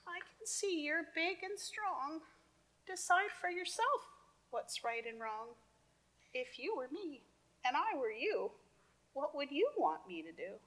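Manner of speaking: high, gentle earnest ant; tiny ant voice. Acted. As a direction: ask it small and high. Ant's final plea.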